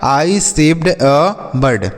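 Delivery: slow, clear question; slow example. Shown as a demonstration. Teacher slowly models the question for children. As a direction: draw the question out. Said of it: "मैंने एक पक्षी को बचाया".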